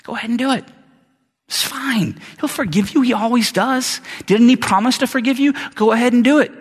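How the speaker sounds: high, coaxing, wheedling devil; tempting devil voice. Acted. Preacher voicing the devil's coaxing lie to a believer, high and wheedling. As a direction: sweet-talk the sinner like a tempter. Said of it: "The devil will tell you this lie".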